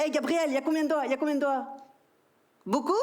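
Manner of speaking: put-on high, fast, sing-song voice for a small kid; a parent's exaggerated baby-talk; talking to a toddler. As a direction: coax a four-year-old. Teaching son to count, mock.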